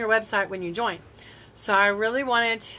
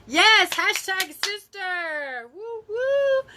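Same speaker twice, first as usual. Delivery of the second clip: high, drawn-out celebratory whoop; excited cheer; 10 semitones higher, 4 dB louder, much slower than usual. Real, not acted. Breaks off to cheer a viewer.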